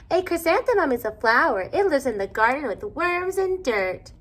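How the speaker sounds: high, loud smug tone; know-it-all. A narrator's reading. Victoria showing off to the class during nap time, high and smug. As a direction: show off to the class.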